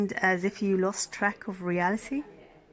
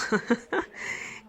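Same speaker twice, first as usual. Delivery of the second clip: airy giggle; 4 semitones higher than usual. Acted. Nurse teasing patient about his head, airy giggle.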